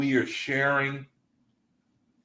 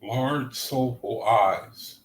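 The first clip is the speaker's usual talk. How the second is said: slow, dreamy reading; much slower than usual. Shown as a demonstration. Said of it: "What is a large, soulful eye?".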